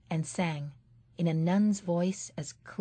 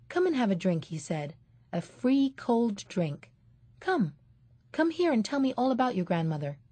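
higher, wheedling lure; coaxing; 5 semitones higher than usual. Acted. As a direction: coax the child over sweetly. Man luring the boy.

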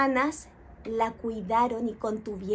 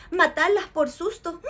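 plain reading voice

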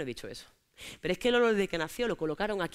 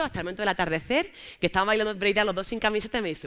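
usual speaking voice

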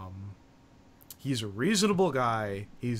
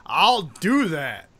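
loud slow retort; 4 semitones higher, 8 dB louder, much slower than usual. Retold or imitated. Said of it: "he's like".

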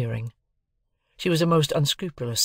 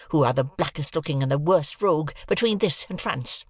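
working into rage; 6 semitones higher than usual. A narrator's reading. Sister insults husband and journeyman, working into a rage. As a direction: pile up insults at a raised pitch. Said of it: "work herself into a mighty rage".

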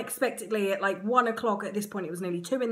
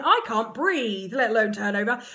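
strained thought; 5 dB louder than usual. Retold or imitated. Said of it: "And I'm thinking".

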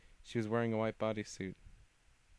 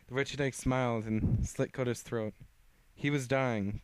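ordinary delivery

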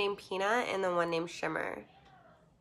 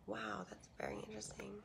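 whispering; 13 dB quieter, faster than usual. Real, not acted. Hushed reaction to comment.

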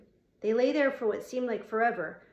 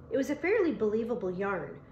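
ordinary delivery, nothing marked

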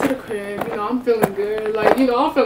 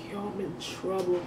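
much softer, worried under the breath; hushed panic; 3 semitones lower, 11 dB quieter than usual. Retold or imitated. Realizing she's in trouble, muttering softly to herself. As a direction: keep it low so dad won't hear. Scared whisper to self.

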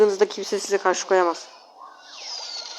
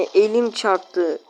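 normal talking voice

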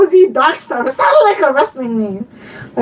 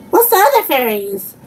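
usual speaking voice

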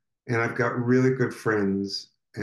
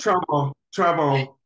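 higher-pitched falling alert; flashing warning label; 5 semitones higher than usual. Acted. Speaker voicing own warning label for a laugh, flashing alert. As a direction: lightly play a warning sign going off. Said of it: "it would flash and it would say".

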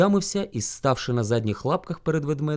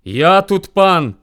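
loud, slow, emphatic boast; lordly bear shout; 3 semitones higher, 10 dB louder, much slower than usual. A narrator's reading. Rival bear asserts his rule, imperious shout. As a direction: proclaim yourself master. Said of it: "кричить він".

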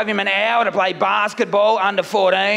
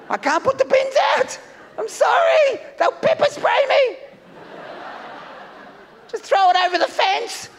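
much higher, shouting in mock panic; panicked squeal; 11 semitones higher than usual. Comic acting out a Melbourne curfew resident, panicking in a high shriek. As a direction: yelp it like you're terrified of police.

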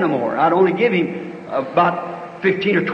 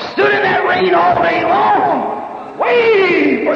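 high, slow shouted preaching line; 9 semitones higher, slower than usual. Acted. Preacher shouting how the mother stood in the rain. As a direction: stretch every word out to the back row.

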